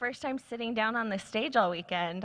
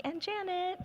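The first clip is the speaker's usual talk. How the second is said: high, rising announcer welcome; excited welcome; 10 semitones higher than usual. Real, not acted. Host welcomes the panelists to the stage, high excited call. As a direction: hype up the last names.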